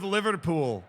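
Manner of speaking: Liverpool with both Os sounded; Scouse pronunciation. Shown as a demonstration. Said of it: "You've gotta pronounce both O's".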